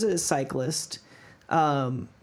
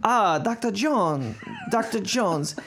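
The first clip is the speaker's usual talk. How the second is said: hammed-up German accent, higher; 6 semitones higher than usual. Mock German accent for the podcast hosts, quoting the cyclist. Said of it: "let me get my German accent".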